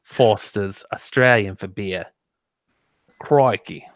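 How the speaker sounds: slow Australian beer-advert voice; Australian accent. Acted. Quoting the beer commercials, put-on Australian accent. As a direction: imitate an Australian advert, unhurried. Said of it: "Now you got the voice".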